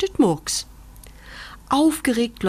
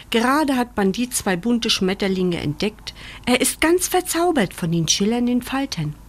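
ordinary delivery, nothing marked

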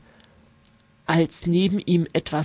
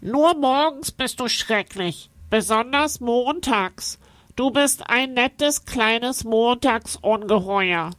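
high voice through the nose; teasing nasal creature; 4 semitones higher than usual. A narrator's reading. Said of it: "Es spricht durch die Nase".

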